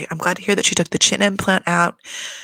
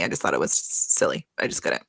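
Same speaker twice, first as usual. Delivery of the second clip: low deadpan mutter; 4 dB quieter than usual. Real, not acted.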